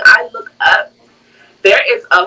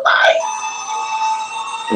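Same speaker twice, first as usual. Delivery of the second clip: mock scared gasp; 7 dB quieter than usual. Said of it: "my date turned around".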